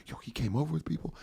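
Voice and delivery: soft, quick, defensive reply; re-enacted furtive explanation; hushed excuse. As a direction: answer fast under your breath.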